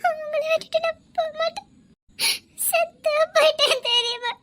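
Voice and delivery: tearful pleading, breaking into sobs; crying. Acted. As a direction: plead through tears. Wife crying at husband's bedside.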